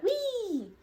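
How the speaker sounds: high, playful mock Wee of a big wrestler diving; gleeful diving cry. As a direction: squeal it like a kid on a slide. Bronson diving, voiced gleefully.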